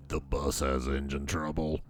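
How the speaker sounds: low, soft, groggy driver voice; bus driver. Acted. Bus driver tells the waiting passengers bad news, low and groggy. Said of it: "The bus driver says".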